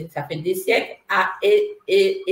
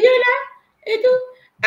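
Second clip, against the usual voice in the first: high dismayed squeal; 7 dB louder, much slower than usual. Retold or imitated. Dismay at yet another twin flame.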